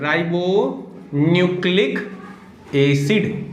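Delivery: stretched "ribonucleic", pause, then "acid"; slow dictation. Shown as a demonstration. Teacher giving RNA's full form to students, slow dictation. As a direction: dictate "ribonucleic acid" slowly in separate pieces.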